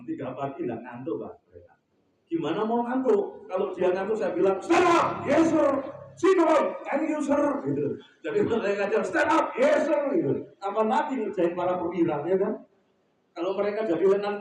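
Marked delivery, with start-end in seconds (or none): 4.6-7.4 high, barked military orders and replies; mock cadet drill shout; drill commands. Imitates drilling navy cadets awake. 9.0-10.0 high, barked military orders and replies; mock cadet drill shout; drill commands. Imitates drilling navy cadets awake.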